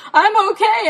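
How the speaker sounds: high, loud call from far off; shouting back. Retold or imitated. Stuck Jen answering her searching dad, shouting high and loud. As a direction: yell so he can hear you.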